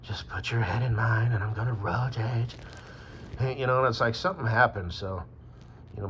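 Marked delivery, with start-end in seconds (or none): 0.0-2.5 mock ASMR whisper to the viewer. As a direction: breathe it like an ASMR video. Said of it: "And now you're like".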